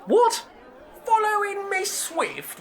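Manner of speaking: high, shocked disbelief; squawking what, utterly puzzled; baffled outburst. Acted. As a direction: spit it back in amazement. Inspector baffled.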